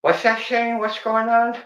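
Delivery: teasing coworker. Retold or imitated. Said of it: "He's always like".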